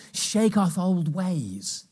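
preacher stretching the point slowly; drawn-out proclamation. Acted. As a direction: stretch each word out to land it. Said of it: "I'll do it briefly".

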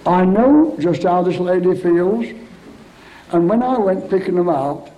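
loud, fervent preacher's reassurance; fervent preaching. Acted. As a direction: lift the whole hall with it. Preaching at full force.

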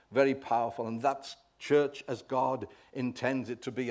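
normal talking voice

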